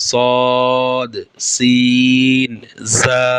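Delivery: জা, সিন, সদ each held long and clear, sin stretched very slowly; Arabic letter sounds. Shown as a demonstration. Teacher demonstrating জা, সিন, সদ to students, drawn out. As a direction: sound out জা, সিন, সদ crisply, one by one like a Quran teacher. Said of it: "এই তিনটি হরফ উচ্চারিত হয়".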